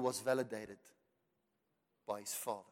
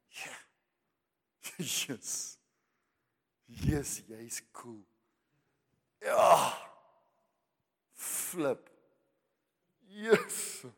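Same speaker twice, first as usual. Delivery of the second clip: hammed-up eager yes-men; mock approval seekers; 4 dB louder, much slower than usual. Preacher mocking eager praise. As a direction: mimic people gushing approval.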